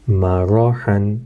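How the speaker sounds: مراحن slow with a thick heavy r. Shown as a demonstration. Teacher modelling heavy ra, slowly. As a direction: draw out مراحن. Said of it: "را موٹا تھا".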